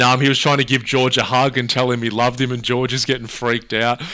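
ordinary talk, nothing marked

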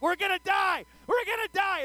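high, loud panicked cry; terrified wail. Retold or imitated. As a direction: wail it as the coaster tips over the drop. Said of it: "I'm like".

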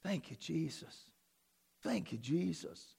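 low, very soft thanks; quiet, reverent aside; hushed praise. Real, not acted. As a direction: murmur it as a private prayer. Murmured private praise.

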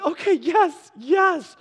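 high, loud, eager shout; eager yes. Retold or imitated. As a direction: jump at the offer. Boy eagerly agrees to prayer.

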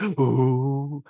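gasp, then a long impressed ooh; mock awe. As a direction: act the whole room marvelling. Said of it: "they're all like".

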